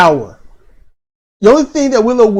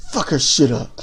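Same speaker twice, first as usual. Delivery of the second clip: imitating low menace under the breath; low, softer mock threat, said under the breath; menacing undertone; 7 semitones lower, 6 dB quieter than usual. Imitating Cedra egging on her wrestler, menacing undertone. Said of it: "says it under her breath with this menacing tone".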